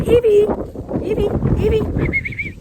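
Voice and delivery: loud high call; calling the dog. Real, not acted. Owner calling her puppy, loud then drawn out. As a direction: call her name across the garden.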